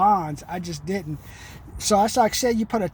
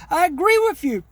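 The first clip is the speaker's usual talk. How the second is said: higher, bright pitch-man voice, agreeing with the caller; agreeable sales voice; 7 semitones higher, 3 dB louder than usual. Pretend. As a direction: play the friendly salesman giving in. Role-plays agreeing with a customer.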